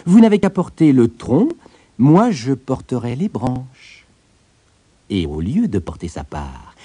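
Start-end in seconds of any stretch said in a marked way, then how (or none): 0.1-4.1 raised, louder voice, slowing at the end; wily tailor. A narrator's reading. Tailor tricking giant into carrying the trunk, wily. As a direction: propose it brightly, as if fair.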